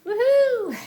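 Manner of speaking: very high, loud whoop; whooping cheer. Real, not acted. Nearly done cleaning the camper: happy whoop. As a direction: let out a joyful whoop.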